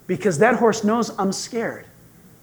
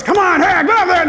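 higher, louder mock cheer; urging shout; 8 semitones higher, 5 dB louder than usual. Riding guide loudly urging the rider forward. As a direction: hype the rider up. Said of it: "And the guy goes".

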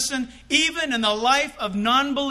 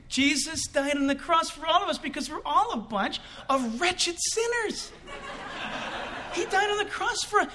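higher, mock-zealous street preacher; hammed-up preaching; 7 semitones higher than usual. Preaching at the office, hammed-up and higher. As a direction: overdo the evangelist to your coworkers.

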